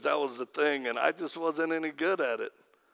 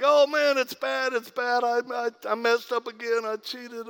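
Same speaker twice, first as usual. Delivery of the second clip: pleading addict; 7 semitones higher than usual. Retold or imitated. Addict confessing to pastor.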